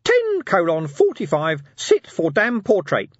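high, loud, slow announcement; robotic reminder. Acted. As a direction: read the alarm like a machine. Imp reads reminder.

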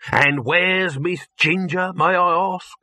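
high, very drawn-out demand; pointed inquiry. A narrator's reading. Producer missing his actress, pointed. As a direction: ask with heavy mock politeness.

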